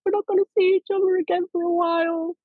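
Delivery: imitating the cast in tears at wrap; tearful goodbye. Cast crying at the wrap party, mock tearful. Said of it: "we were all in tears".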